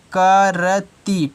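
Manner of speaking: करती very slowly as kaa-ra-tee; syllable by syllable. Shown as a demonstration. Teacher asks what it is, करती slowly sounded out. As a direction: draw out each syllable of करती.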